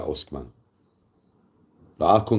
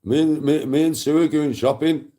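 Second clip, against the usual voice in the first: much higher, louder mock granddad call; imitating dad; 9 semitones higher, 5 dB louder than usual. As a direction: play dad calling the grandkid off the beach. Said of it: "my dad's gone".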